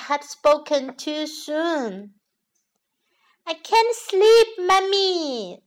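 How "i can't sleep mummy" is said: put-on high, loud child bunny voice; youngest little bunny. A narrator's reading. Littlest bunny calling to Mummy from bed, high childish complaint. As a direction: pipe up like a sleepless little one. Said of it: "said her youngest little bunny".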